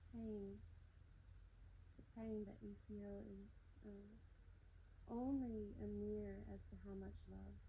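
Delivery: hushed guided meditation. Acted. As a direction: ease them gently inward. Soft guided meditation lines.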